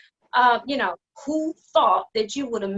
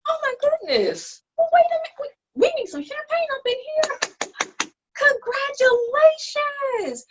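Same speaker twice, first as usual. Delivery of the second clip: sarcastic congratulations pitched way up, louder; mock excitement; 4 dB louder than usual. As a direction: gush fake delight to belittle her. Sister mocks the degree news.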